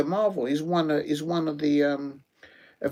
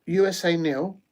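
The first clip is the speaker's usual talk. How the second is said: usual speaking voice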